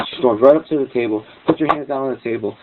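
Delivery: ordinary talk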